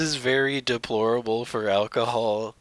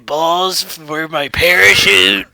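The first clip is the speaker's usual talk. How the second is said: loud; 3 semitones higher, 8 dB louder than usual. Pretend. S0 plays stuntman, loud.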